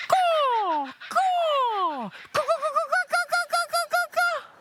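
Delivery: high cawing bird call; crow call imitation. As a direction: caw like a crow, high and nasal. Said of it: "I love that bird call".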